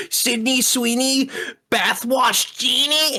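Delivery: high, slow, whiny mimicry of a commercial pitchman; whiny ad guy. Host mocking the annoying soap commercial guy, whiny voice. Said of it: "he does it with that whiny voice".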